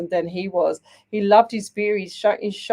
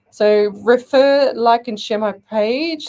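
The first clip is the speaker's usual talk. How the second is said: slow, louder emphatic call to share; emphatic plea; 4 semitones higher, 6 dB louder, slower than usual. Real, not acted. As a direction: stretch it out and push each word. Urging viewers to share page.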